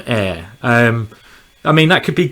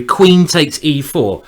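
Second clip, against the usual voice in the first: ordinary delivery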